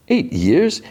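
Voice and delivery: high, rising disbelief; incredulous echo. Retold or imitated. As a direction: throw it back as a stunned, high-pitched question. Retold incredulous echo of answer.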